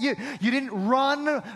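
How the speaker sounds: ordinary delivery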